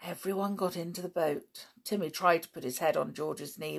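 plain reading voice

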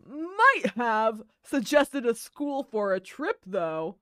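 put-on slow, loud old man; slow elderly voice, leaning in. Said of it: "You randomly got very elderly".

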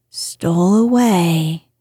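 plain reading voice

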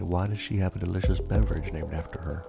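plain reading voice

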